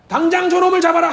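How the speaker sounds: angry command. Acted. King ordering David seized.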